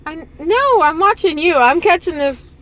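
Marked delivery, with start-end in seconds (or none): none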